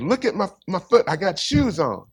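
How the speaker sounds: high, excited outburst of astonished delight. Retold or imitated. Woman healed in the meeting tells the preacher, excited. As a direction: retell her excited cry, pitched well up. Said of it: "she was all excited".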